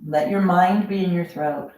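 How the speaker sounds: ordinary delivery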